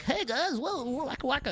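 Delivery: high, goofy clowning voice; goofy mock astronaut. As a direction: ham it up for a camera no one watches. Astronauts goofing on camera.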